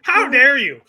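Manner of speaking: high, loud mock indignation; playfully outraged outburst; mock outrage. Mock outrage amid joking banter.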